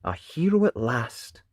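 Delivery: ordinary delivery, nothing marked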